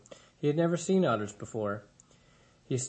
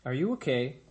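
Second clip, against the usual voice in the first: plain reading voice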